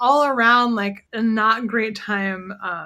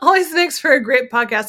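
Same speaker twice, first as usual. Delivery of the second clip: laughing irony; 4 semitones higher, 7 dB louder than usual. Real, not acted. Ironic laughing remark.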